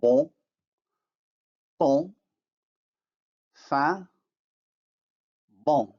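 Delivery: bon, ton, fin, bon with nasal vowels; French demo words. Shown as a demonstration. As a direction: nasalize each vowel, drop the n. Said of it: "as in the words".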